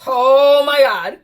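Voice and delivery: loud, slow, thrilled exclamation; shouting with joy; excited shout. Real, not acted. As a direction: cry out in delight. Card confirms pregnancy.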